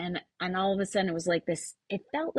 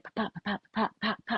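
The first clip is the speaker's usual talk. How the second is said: softer, light rhythmic pops; soft bouncy pops; 6 dB quieter, faster than usual. Shown as a demonstration. Retelling the downhill run to the host, soft rhythmic pops. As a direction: skip the pops like hopping rocks. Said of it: "I could just pop and skip".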